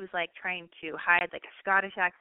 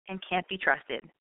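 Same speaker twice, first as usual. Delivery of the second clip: ordinary talk, nothing marked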